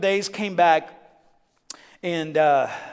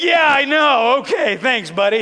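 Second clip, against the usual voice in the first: sarcastic thanks; 8 semitones higher, 10 dB louder, slower than usual. Retold or imitated. Sarcastic reply to mechanic.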